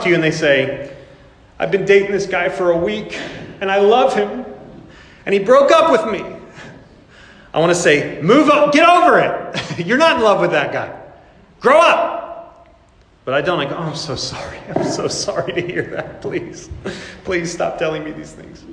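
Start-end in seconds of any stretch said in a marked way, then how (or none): 1.6-6.2 put-on higher, whiny lovesick teen, building to a laugh; lightly mock the teen's lament; mock heartbroken teen. A hint of a teen mocked in a sermon, rising whine. 8.2-12.2 higher, louder exasperated scolding; mock impatient scolding. To a heartbroken teen, higher, louder mock scold. What preacher wants to say. 13.8-18.6 softer, half-whispered mock pity; fake sympathy. To a heartbroken teen, soft mock-sympathetic murmur.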